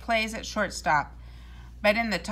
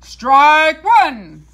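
loud, higher umpire bellow; umpire's call; 5 semitones higher, 14 dB louder than usual. A narrator's reading. The umpire calls the first strike, a loud high bellow. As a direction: call it out across the field. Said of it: "shouted the umpire".

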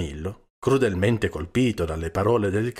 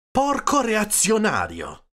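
high, slow, loud name-calling; indignant cry at raised pitch; exclaimed insult; 13 semitones higher, 6 dB louder, slower than usual. A narrator's reading. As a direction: hurl the insult, stretching it out. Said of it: "esclamò Don Camillo".

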